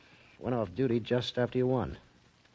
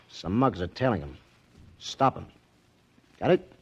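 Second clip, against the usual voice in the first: normal talking voice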